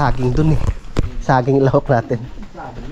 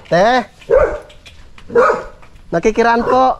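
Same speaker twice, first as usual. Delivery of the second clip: high polite call across; calling from far away; 8 semitones higher, slower than usual. Real, not acted. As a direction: call out asking to pass. Calling for passage. (pagtawag mula sa malayo)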